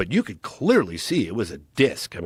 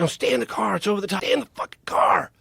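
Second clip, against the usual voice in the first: frantic yell; 8 semitones higher, faster than usual. Retold or imitated. Frightened friend yelling at the kid to stay in the car. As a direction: pitch climbing high to a strained, breathy shout.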